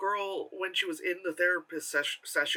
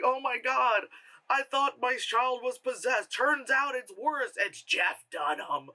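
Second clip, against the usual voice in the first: mock horror; 7 semitones higher, slower than usual. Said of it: "And then I'm like".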